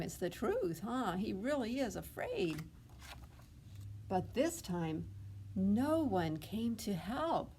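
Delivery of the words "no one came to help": usual speaking voice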